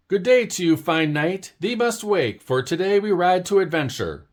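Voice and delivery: high, grand, hammed-up old knightly talk; a little bird playing a noble knight; mock knight speech. Early Bird waking Owl, hammed-up knightly call. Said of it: "Knights don't talk like that".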